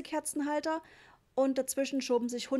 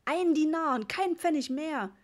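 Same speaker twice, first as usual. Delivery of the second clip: high, loud angry refusal; cross, raised-voice ultimatum; furious shout; 6 semitones higher, 5 dB louder than usual. A narrator's reading. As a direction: yell it as an enraged dad haggling. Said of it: "schrie Papa wütend".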